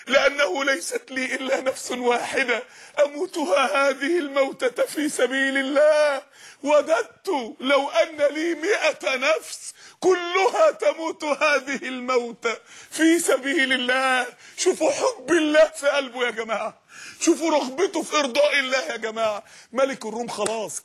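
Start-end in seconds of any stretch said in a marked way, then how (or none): 0.1-14.3 slow, choked, weeping through the martyr's words; tearful recitation. Acted. Preacher retelling the martyr's answer, slow and tearful. 14.6-19.4 crying out through tears; tearful exhortation. Real, not acted. Preacher to the congregation, tearful cry.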